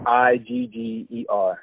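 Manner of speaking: I G G E R spelled very slowly letter by letter; spelling it out. Shown as a demonstration. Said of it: "D as in dog".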